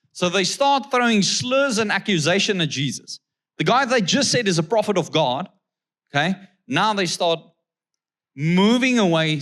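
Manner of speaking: usual speaking voice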